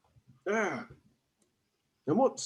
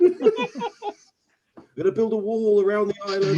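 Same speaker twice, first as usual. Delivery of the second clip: mock politician; 7 semitones higher, 7 dB louder than usual. Player mocks politician.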